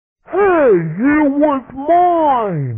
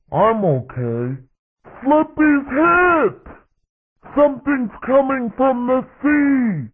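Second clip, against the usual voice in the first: animated battle-radio sidekick lines; game character voice. Acted. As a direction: act out short cartoon combat callouts.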